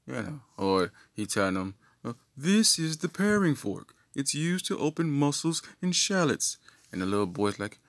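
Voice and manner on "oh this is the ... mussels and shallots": high, mannered teaching voice; mock instructor. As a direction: present it like a mock etiquette tutor. Brother teaching boy fine tableware.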